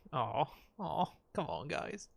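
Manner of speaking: soft aww at a sad scene; softly moved by the comrades' loyalty; touched, soft. Real, not acted.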